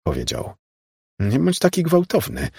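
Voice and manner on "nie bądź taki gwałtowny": a much higher startled protest. A narrator's reading. Laura protests after Raymond grabs her wrist, higher. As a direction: push back, hurt and indignant. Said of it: "zaprotestowała Laura".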